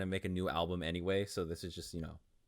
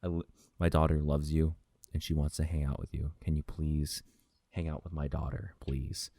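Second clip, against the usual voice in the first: low, earnest father's voice; deep, measured, heartfelt request; genuine, honest plea; 7 semitones lower than usual. Retold or imitated. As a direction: imitate a sincere dad asking a favour, pitched way down. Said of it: "super genuine and honest".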